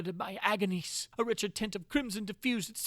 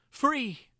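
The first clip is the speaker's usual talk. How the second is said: higher and louder, a sharp ironic cry of Free!; bitter exclamation; 7 semitones higher, 6 dB louder than usual. A narrator's reading. Prisoner realizing his freedom is a trap, bitter cry. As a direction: spit it out as a cruel joke.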